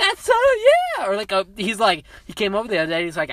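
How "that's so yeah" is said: high, loud delighted yell; squealed burst of enthusiasm; excited cheer. Retold or imitated. Grandson reacting to a camera from grandfather, excited.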